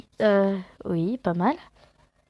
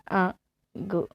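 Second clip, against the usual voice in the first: usual speaking voice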